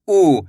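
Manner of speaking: "ऊ" stretched as a long vowel; drawn-out vowel. Shown as a demonstration. Teacher showing learners the big u, "ऊ" stretched. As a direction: draw out the "ऊ". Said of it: "इसको खींच के पढ़ेंगे".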